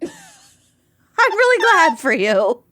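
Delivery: slow, high Minnesotan sing-song; Minnesotan accent. Shown as a demonstration. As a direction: overdo the Minnesota nice. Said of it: "unleash our worst Minnesotan accents".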